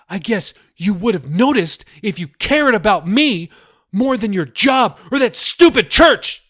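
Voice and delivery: high, loud defiant outburst; defiant rant. A narrator's reading. Daughter defies her father, high and loud. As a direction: spit it back at him.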